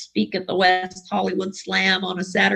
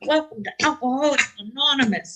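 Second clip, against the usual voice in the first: sullen greeting; 4 semitones higher than usual. Retold or imitated. Newcomer greeter welcoming people at the door, grudging and mock sullen. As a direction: toss off the welcome like she'd rather not.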